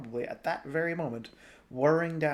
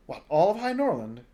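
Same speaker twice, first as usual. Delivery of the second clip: surprised; 6 semitones higher, 9 dB louder than usual. A narrator's reading. Girl astonished.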